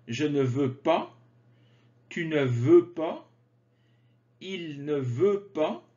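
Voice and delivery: usual speaking voice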